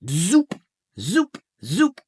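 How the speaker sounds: high, loud cartoon zoops; squeaky rising zoops; zoop sound effect. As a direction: fire off each zoop like a machine.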